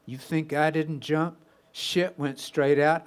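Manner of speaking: plain reading voice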